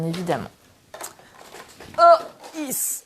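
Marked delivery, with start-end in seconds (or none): 2.0-3.0 higher, louder grunt of effort; effortful heave-ho. Real, not acted. Crafter heaving at her machine, effortful heave-ho. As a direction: heave it out while pushing.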